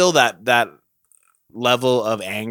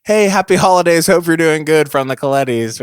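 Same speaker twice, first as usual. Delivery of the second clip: higher, louder holiday greeting, gradually easing; cheery mock greeting; 7 semitones higher, 8 dB louder than usual. Man voicing a holiday text to his exes, cheery mock greeting.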